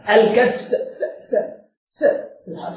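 الكثة drawn out very slowly, then the th hissed alone; sounding out the letter. Shown as a demonstration. Teacher showing students the tha in الكثة, slow voiceless th. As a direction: stretch الكثة and breathe out the th sound twice, voiceless. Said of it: "يعني بحرف الثاء".

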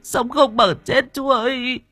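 high, blubbering, stammered begging; whimpering tearful plea. A narrator's reading. Woman begging him not to call police, blubbering. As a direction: plead through tears, voice pitched high. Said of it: "Mụ Vi vẫn cứ mếu máo".

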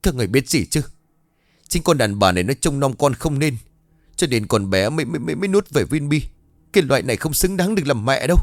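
high-pitched accusing tirade; heated rant, stumbling over words; angry scolding. A narrator's reading. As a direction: lash out and blame your wife. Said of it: "những lời mắng chửi xì vả này".

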